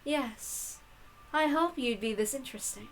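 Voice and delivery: soft, lofty witch voice; hushed and superior, bored with everything; haughty witch. Acted. Witch answers Angie's challenge.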